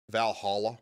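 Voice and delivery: Valhalla as "val-HAH-luh", rising; other region's pronunciation. Shown as a demonstration. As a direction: try Valhalla with "hall" in the middle. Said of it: "You say holla, don't you?".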